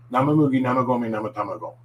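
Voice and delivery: quick, run-together tongue twister; brisk speed demo in one go; pretty fast. Shown as a demonstration. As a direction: rattle it off rapidly without a slip. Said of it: "at a pretty fast speed".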